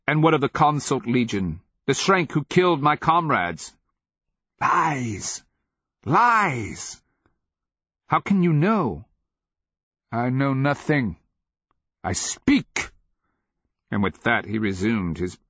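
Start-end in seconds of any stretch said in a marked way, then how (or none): 0.1-3.7 raised, higher-pitched demand; indignant protest; heated challenge. A narrator's reading. King challenges in quarrel. 4.6-7.0 high rasping cry, building; fierce slave's outburst; angry denial. A narrator's reading. Slave denies angrily. 8.1-12.9 high, strained insistence; impassioned retort; heated exchange. A narrator's reading. Quarrel between king and slave.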